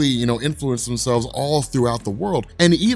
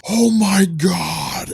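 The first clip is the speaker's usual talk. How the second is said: drawn-out, high and loud; breathless awe; 6 semitones higher, 4 dB louder, much slower than usual. Retold or imitated. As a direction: stretch it out in awe. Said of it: "catch my breath".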